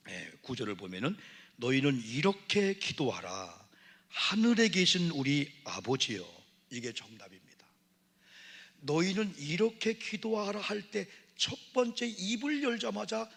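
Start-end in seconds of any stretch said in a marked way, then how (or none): 1.6-6.3 slow, louder solemn recitation of the Lord's prayer; preaching scripture. Acted. 6.7-7.7 whisper. Acted. Aside after scripture. 8.8-13.3 slow, louder solemn recitation of the Lord's prayer; preaching scripture. Acted. Preacher proclaiming.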